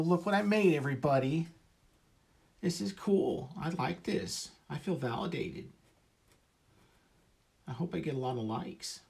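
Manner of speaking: put-on high squeaky baby voice. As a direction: gush like a needy toddler. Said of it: "That's my baby voice I use when I post".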